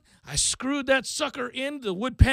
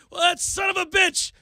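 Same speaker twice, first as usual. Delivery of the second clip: gleeful mock outrage; 8 semitones higher than usual. Mock outrage, actually delighted.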